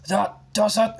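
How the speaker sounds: strained, barely held temper; forced calm. A narrator's reading. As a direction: keep a lid on the fury. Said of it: "trying to speak calmly".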